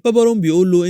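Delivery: ordinary delivery, nothing marked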